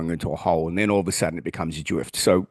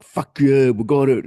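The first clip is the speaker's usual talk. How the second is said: mock triumphant cheer; 5 semitones higher, 6 dB louder than usual. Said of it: "and we're like".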